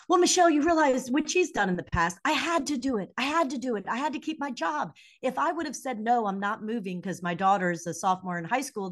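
hammed-up father defending his choices; defensive dad. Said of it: "defend and say".

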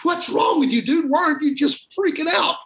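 buddy bouncing on the bed yelling at his friend, excited. Retold or imitated. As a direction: yell it like you can't believe he's calm.

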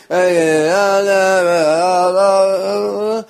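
singers forgetting the words; mumbled nonsense. Retold or imitated. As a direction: trail off into mumbled uhs. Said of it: "just a bunch of mumbled nonsense".

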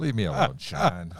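high-pitched playful complaint; mock protest. Teased about pronunciation, protests laughingly.

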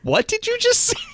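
high mock-incredulous question, breaking into laughter; incredulous call-out. Co-host imitates Travis calling her out, mock incredulous. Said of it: "He's like".

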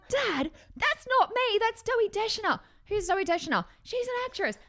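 high, frantic protest. Retold or imitated. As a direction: insist desperately, voice squeaking up. Frantic phone call with confused dad.

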